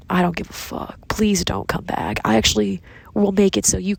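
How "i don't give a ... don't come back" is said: ordinary delivery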